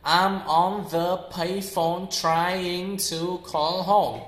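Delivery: very slow, word-by-word "I'm on THE payphone"; laboured learner's diction, THE in full; over-clear every sound. Shown as a demonstration. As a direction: pronounce every sound of PAYPHONE and HOME separately, slowly. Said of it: "trying to pronounce very clearly every sound".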